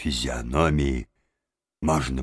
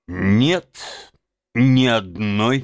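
firm, slightly raised voice; firm refusal; 5 semitones higher, 4 dB louder, slower than usual. A narrator's reading. Rat turning down Toad's plea for a song, firmly. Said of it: "твёрдо сказал мистер Крысси".

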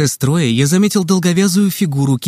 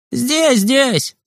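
high, eager call from behind a fence; excited cry; 10 semitones higher than usual. A narrator's reading. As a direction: shout with relief, much higher. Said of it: "вскричал невидимый Костик". (радостно и высоко выкрикнуть)

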